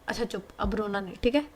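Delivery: soft, hushing comfort; quietly calm her down as you stroke her back; a girl soothes her crying niece softly; soothing a crying girl. Acted. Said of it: "पीठ है उसे सहलाती है और बोलती है".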